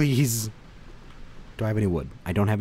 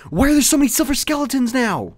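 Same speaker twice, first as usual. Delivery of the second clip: high, full-force shout of protest; exasperated yell; 13 dB louder than usual. Real, not acted. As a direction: yell in outrage at being swarmed. Overwhelmed by skeletons in game.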